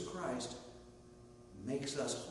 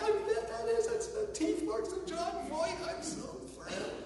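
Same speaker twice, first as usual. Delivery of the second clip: very high, giddy squeal breaking into laughter; hammed-up overjoyed fan, voice shooting up; ecstatic. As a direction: squeal it like you can barely contain yourself. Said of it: "[name] just got ecstatic".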